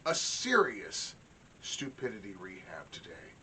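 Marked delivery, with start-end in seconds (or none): 1.6-3.3 комичный шёпот в сторону; whispered aside, breathy, no voice. Pretend.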